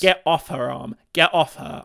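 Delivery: loud mock order to an attacker; hammed-up loud command; mock scolding. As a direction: call out as if shooing off an attacker. Pretends to scold the arm.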